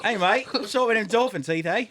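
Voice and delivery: teasing a friend in a hammed-up Australian accent. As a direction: ham up a joking Australian bloke. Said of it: "He just did Australian".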